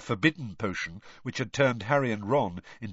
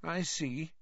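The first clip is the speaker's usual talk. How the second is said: morose; 6 semitones higher, 5 dB quieter, slower than usual. Acted. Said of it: "in a morose sort of way".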